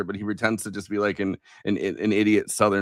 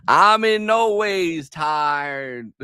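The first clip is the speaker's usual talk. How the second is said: very slow, higher mock Southern preacher accent; Hillary Clinton's fake preacher accent; 6 semitones higher, 4 dB louder, much slower than usual. Mimicking a candidate talking to a church, fake preacher accent. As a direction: stretch every word out in a put-on church-preacher accent. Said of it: "preacher accent".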